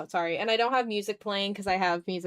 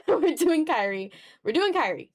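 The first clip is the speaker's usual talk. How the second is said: excited decision; 7 semitones higher, 4 dB louder than usual. Retold or imitated. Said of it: "I said".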